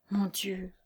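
soft, whispered despair. A narrator's reading. Lucie wringing her hands in despair, whispering. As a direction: breathe it out hopelessly. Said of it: "bégaya Lucie ... avec désespoir".

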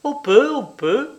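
high, singsong invitation to enter; calling back. A narrator's reading. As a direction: call back high, rising each time. Answering call from next room. (réponse lancée d'une voix aiguë et montante)

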